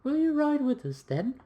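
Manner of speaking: high, loud put-on girl voice. Young girl challenging the gentleman to ride, put-on girl voice. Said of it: "I have to do a girl accent there".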